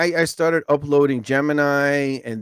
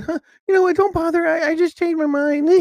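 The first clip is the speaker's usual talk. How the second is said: much higher, prissy mimicry of a fickle viewer; mocking whiny viewer. Mocking TikTok users who refuse his invite, high whiny voice. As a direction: mimic them as petty and fickle.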